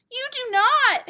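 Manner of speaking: high, loud cry of protest; a little sister's furious denial; outraged shout. Acted. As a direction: shout it down indignantly. Henrietta protests the egg claim.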